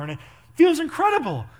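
high, loud burst of elation; excited outburst. Retold or imitated. Acting out groom's excited outburst.

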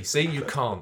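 normal talking voice

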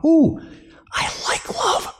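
high, breathy cooing over a favourite pick; mock picky shopper. Preacher mocking a churchgoer picking traits, a cooing voice. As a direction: coo it like choosing candy.

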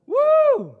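very loud high whoop; loud high celebratory woo; joyful whoop. Real, not acted. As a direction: shout woo with joy. Preacher shouting joy.

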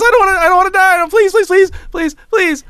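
very high, repetitive mock begging; pleading little kid. Trolling kid begs not to die, mock-desperate pleading. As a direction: imitate a kid frantically pleading. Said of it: "He goes".

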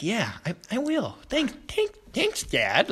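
put-on high, small, stammering child-like voice; meek, high-pitched mock thanks; grateful little kid. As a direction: imitate a shy boy soaking up praise. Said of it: "almost like this little, like, little kid".

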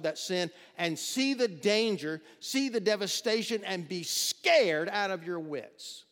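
fiery sermon warning of sin; full-force preaching. Acted. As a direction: thunder it from the pulpit as a warning. Preacher exhorting at full volume.

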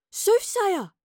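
high, loud call of recognition; cried out. A narrator's reading. Astrid recognizes the hooded figure, crying out high and loud. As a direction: cry out sharply to the cloaked figure. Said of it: "she cried".

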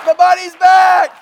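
loud, high drawn-out yell; horrified owner's cry. Acted. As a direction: cry out in mock horror. Repeats horrified owner's cry for laughs.

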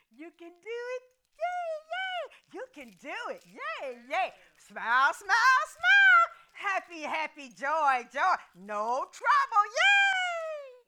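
mocking, high, loud cheerleader chant. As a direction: parody over-peppy cheering, rising to shouted yays. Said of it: "they like cheerleaders".

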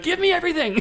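very high, loud mock shout; greedy yell. First-class passenger grabbing everything, mock yell. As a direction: shriek it like a greedy kid.

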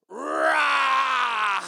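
put-on sudden loud roar to scare the kids; mock bear roar. Grandpa scaring kids in the dark, loud roar. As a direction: jump out with a big rah. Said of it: "dad behind him just goes".

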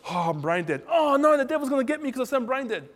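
retelling his own silly panic over a careless word, mock fearful. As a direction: squeal it in fake fear of the devil. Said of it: "One day I go".

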